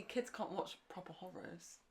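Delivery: ordinary delivery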